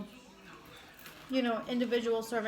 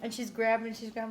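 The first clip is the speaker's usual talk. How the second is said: usual speaking voice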